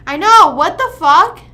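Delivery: loud, rising shocked yell; shocked shout. Real, not acted. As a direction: shout out in disbelief. Shocked by the nosebleed.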